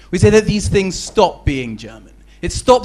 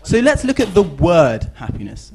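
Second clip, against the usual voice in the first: ordinary delivery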